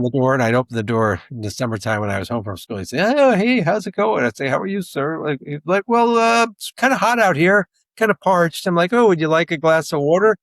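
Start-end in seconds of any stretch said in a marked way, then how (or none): 3.0-4.2 put-on much higher, louder, jovial old mailman; mailman at the door. Imitating the mailman greeting the kid at the door, high and jovial. 4.5-5.2 put-on polite kid. Imitating his younger self. 5.9-8.7 much higher, louder, hinting mailman voice; parched mailman. Retold or imitated. Mailman hinting to the kid that he's thirsty, high and hammed-up. 8.9-10.4 put-on eager kid. Imitating his younger self.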